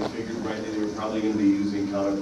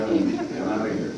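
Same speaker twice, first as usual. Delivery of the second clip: ordinary talk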